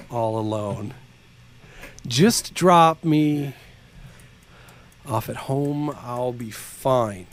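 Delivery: slow, hushed lyric recital; dramatic reading. Acted. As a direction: read the lyrics like a sad poem. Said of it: "dramatic read".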